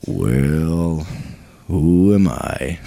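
very low, slow, gloomy donkey drawl; Eeyore voice. Retold or imitated. Preacher imitating Eeyore for the congregation, gloomy and slow. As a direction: sag into it like a mopey donkey. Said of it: "remember Eeyore off of Winnie the Pooh".

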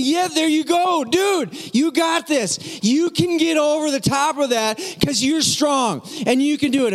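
high, drawn-out coaching holler; cheering on. Retold or imitated. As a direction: urge the child up like a sideline coach. Dad cheering on climbing child.

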